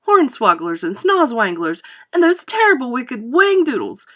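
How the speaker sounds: loud, heightened relish in the horrors; exuberant, emphatic tall-tale telling; Wonka, animated storyteller. Acted. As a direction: hammer up each terrible detail.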